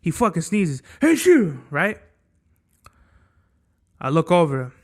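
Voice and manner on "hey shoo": high, loud mock sneeze "hey shoo"; a comic sneeze sound, higher and louder; imitated sneeze. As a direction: burst out a fake sneeze.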